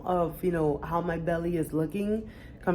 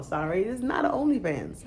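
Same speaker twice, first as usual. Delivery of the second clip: ordinary talk, nothing marked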